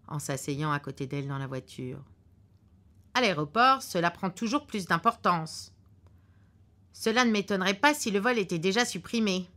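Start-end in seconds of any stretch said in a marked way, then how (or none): 3.1-9.5 the husband's voice, louder and a bit higher, certain of himself; gruff husband. Acted. Husband in the car predicting a cancelled flight, curtly sure. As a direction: lay out the bad news like a know-it-all husband.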